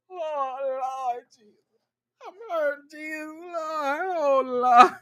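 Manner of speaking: mock weeping, high and drawn out; Irena. Mocking her breaking out in tears, exaggerated wailing. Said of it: "breaking out in tears".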